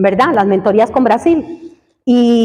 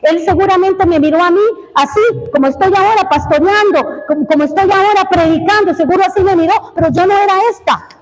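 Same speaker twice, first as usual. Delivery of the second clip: fervent preaching; 6 semitones higher than usual. Acted. Preacher telling the church how God sees them, fervent and raised. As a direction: lift the congregation with conviction.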